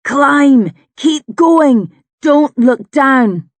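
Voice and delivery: shouted commands, loud and high; urgent shout. A narrator's reading. Drust urging a climber up the cliff in the waves, shouting. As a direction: yell it hard, drawing out the last order. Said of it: "he's shouting in my ear".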